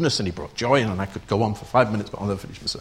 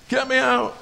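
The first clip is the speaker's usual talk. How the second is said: high panicked cry; prisoner screaming; 13 semitones higher than usual. Retold or imitated. Prisoner in the ship's hold, high panicked cry. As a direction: shriek to be let out. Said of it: "they'd start screaming".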